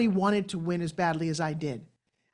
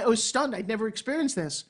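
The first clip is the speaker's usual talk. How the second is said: ordinary talk, nothing marked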